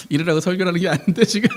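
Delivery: quick words through laughter; laughing protest. Real, not acted. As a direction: protest while still cracking up. Joking protest while laughing.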